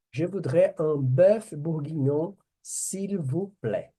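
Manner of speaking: slow, carefully articulated French order; slow teacher demo. Shown as a demonstration. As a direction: model the phrase slowly for learners. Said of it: "teacher models ordering dish".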